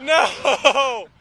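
high, eager cries then dismay; rising hope, then a cry; excited cheering. Real, not acted. Last run, fails.

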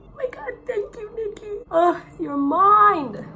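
gushing fan. Retold or imitated. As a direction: swoon over your idol. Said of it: "And I would have been like".

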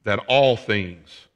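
"all" loud and stretched out slowly; stress on all. Shown as a demonstration. Preacher showing what is underlined in the verse, stressing "all". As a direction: lean hard and long on "all". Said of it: "I underline the word all there".

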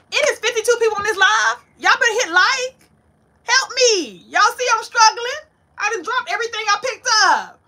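high, loud, half-laughing plea for help; frantic pleading. Real, not acted. Struggling live host begging viewers to hit like, frantic. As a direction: beg the viewers while juggling everything.

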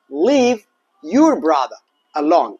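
very slow and clear; slow drill. Shown as a demonstration. Teacher drilling a swapped phrase, slow. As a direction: draw out each word for the class.

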